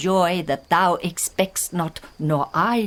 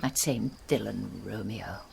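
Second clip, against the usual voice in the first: dark hushed; 9 semitones lower, 10 dB quieter than usual. Acted. Mother names the villain darkly.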